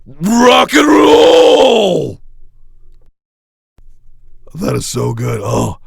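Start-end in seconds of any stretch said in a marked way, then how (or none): 0.1-2.2 rock star voicemail shout. Acted. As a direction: stretch the last words out, very slow and high. Second take of voicemail greeting.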